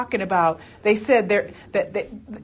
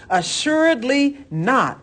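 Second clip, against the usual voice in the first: "Assuredly not!" read slow and higher with a strong falling finish; exclamation point; 6 semitones higher, 4 dB louder, slower than usual. Shown as a demonstration. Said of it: "Exclamation point".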